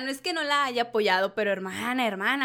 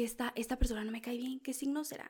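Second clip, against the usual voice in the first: secret whisper, much softer; whispered aside; 10 dB quieter than usual. Retold or imitated. Host confessing her zodiac judging in a whisper. Retold thought about a disliked person.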